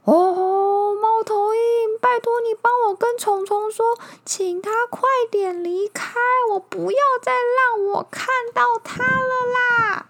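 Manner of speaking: high, loud whiny wail; wailing bear. Acted. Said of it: "哀嚎着说".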